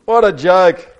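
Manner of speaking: high, loud crocodile scoff; storybook croc jeering; scornful cry. A narrator's reading. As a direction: cry out in contempt. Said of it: "cried Crocodile".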